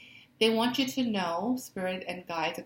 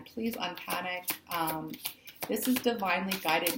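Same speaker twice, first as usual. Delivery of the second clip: usual speaking voice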